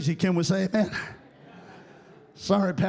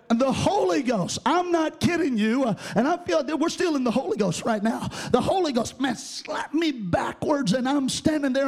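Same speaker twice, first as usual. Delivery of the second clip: normal talking voice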